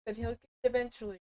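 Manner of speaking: very soft, quick mutter; barely audible, rushed mumble; muttered aside. Real, not acted. Repeats mumbled aside to teammate.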